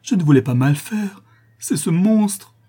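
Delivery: high, loud, frantic outburst; anguished crying out. A narrator's reading. Daughter blames herself for father's madness, anguished cry. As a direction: wail with guilt and horror. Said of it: "s'écria-t-elle".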